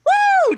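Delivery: high, loud celebratory whoop; crowd cheer. Acted. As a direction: let out an excited "woo" for the whole crowd. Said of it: "like, cheers".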